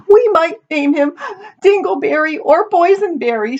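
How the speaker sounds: high, hammed-up tearful wail; weeping. A narrator's reading. Daughter imagines her future son to her mother, weeping. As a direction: sob through it in a very high voice. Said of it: "Amity sat weeping".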